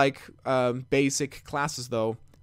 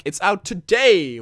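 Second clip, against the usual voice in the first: higher, louder and stretched-out announcer's proclamation; retold announcement; 6 semitones higher, 8 dB louder, slower than usual. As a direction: proclaim it slowly like big stage news. Retelling a press conference announcement.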